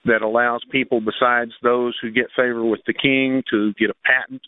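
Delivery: ordinary delivery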